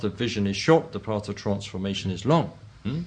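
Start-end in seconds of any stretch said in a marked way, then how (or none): none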